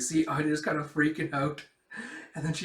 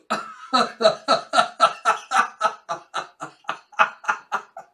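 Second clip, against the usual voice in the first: long, loud peal of laughter; real laughing fit; 8 semitones higher, 8 dB louder than usual. Real, not acted. Storyteller at kibble falling out, real laughing fit. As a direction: crack up helplessly, high and loud.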